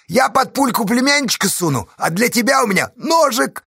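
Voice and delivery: high, gleeful shout; jubilant, high-pitched villain; merry taunting. A narrator's reading. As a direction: threaten him as cheerfully as can be. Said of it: "так же весело пояснил".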